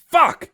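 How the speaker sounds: Fuck! shouted sharp and high; hard fuck. Shown as a demonstration. Showing how he says it, a sharp yelled Fuck!. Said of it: "I say".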